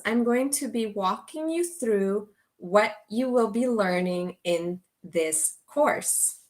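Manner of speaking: usual speaking voice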